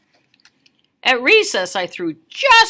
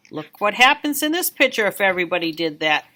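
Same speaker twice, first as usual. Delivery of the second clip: plain reading voice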